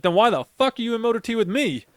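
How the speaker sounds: high, exasperated challenge; retold indignant comeback; indignant retort.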